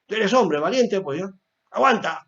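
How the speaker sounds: put-on high, loud tough-love order to a hurt child; a father telling his hurt son to hold it in, loud and high; stern parent. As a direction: bark at the boy to toughen up. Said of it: "Y si algo le duele".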